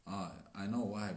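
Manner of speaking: ordinary delivery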